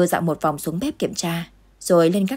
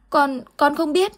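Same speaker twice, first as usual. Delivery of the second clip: put-on terrified child; 9 semitones higher than usual. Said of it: "thái độ hết sức hoảng loạn".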